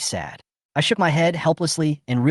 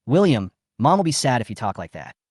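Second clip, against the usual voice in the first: ordinary delivery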